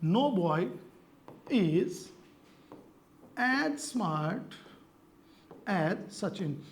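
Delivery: word by word with long pauses; writing-out pace. Real, not acted. Teacher writing the example sentence for students, word by word. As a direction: read it out haltingly as you write it. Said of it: "मैं sentence लिख रहा हूं".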